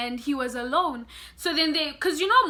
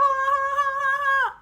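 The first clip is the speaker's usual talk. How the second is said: retold scream; 11 semitones higher than usual. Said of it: "as I'm screaming".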